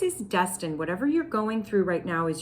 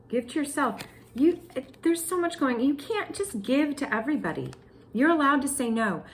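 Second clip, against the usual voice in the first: normal talking voice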